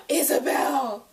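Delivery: dismayed gasp for Isabel. Real, not acted. Gasp then cry for Isabel.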